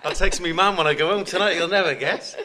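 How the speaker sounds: loud, high, laughing; laughing with delight; excited laughing. Real, not acted. Excited laughing about mum, Twitter.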